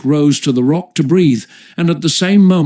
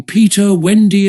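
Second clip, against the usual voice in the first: high, louder calling of names; 6 semitones higher, 4 dB louder than usual. A narrator's reading. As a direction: call across the water.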